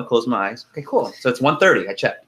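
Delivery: ordinary delivery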